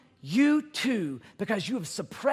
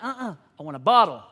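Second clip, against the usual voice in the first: put-on lower, quick babyish demand; imitating demanding baby; 6 semitones lower, faster than usual. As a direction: voice the bossy baby wanting her bottle. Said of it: "She's like".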